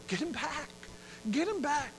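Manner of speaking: frantic call to bring him back; panicked realization; desperate plea. Acted. Prisoner realizes rejected pardon.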